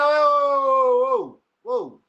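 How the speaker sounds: a loud, high, startled whoa, whoa; shocked whoa. Retold or imitated. Stopping the sex-ed pitch, alarmed and loud. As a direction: throw up the brakes in alarm. Acted shocked reaction to the idea.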